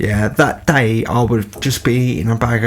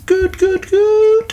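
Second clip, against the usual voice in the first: very high sing-out teacher voice; Mister Gill; much slower than usual. Retold or imitated. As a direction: mimic a teacher chirping approval down the aisle. Voices teacher checking on the coach.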